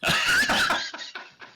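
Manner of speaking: very high breathless laugh; real high-pitched giggle. Real, not acted. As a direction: break up in a high squeal of laughter. Laughs at own tangent.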